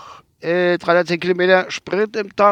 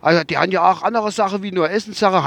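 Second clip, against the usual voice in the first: higher quoted driver; driver's voice; 6 semitones higher than usual. Retold or imitated. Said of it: "hat er gesagt".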